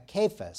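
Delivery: Cephas with a hard K, KAY-fus; Greek pronunciation. Shown as a demonstration. Preacher showing the congregation the name, Cephas as KAY-fus. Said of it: "sometimes pronounced Cephas, but in Greek".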